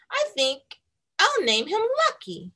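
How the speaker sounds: put-on high bright girl voice; name him with delight; happy little girl. Girl naming her piñata dog, delighted.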